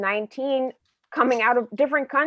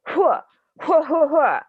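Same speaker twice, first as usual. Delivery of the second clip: evil laugh; 5 semitones higher, 4 dB louder than usual. Acted.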